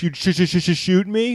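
high, stammered question rising at the end; mocking stutter. Victim mocks the stammering mugger, incredulously.

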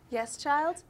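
mock teacher. Plays teacher answering the kid.

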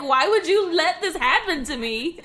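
loud, high, outraged yell; angry yell. Retold or imitated. Daughter yelling at her mom, angry and loud. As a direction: demand an answer, furious. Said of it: "I was like".

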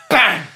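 loud mock gunshot yell; shouted bang.

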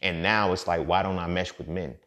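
normal talking voice

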